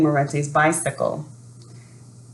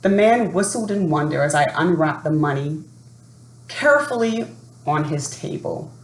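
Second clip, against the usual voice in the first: ordinary delivery, nothing marked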